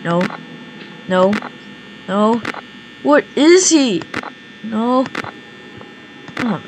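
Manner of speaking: normal talking voice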